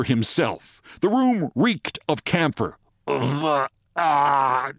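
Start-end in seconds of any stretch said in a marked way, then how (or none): 3.1-4.7 acted choking, like a drowning man; strangled "ugh, ah"; choking groan. A narrator's reading. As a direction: choke and groan for air, hammed-up. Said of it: "choked Briggs like a drowning man".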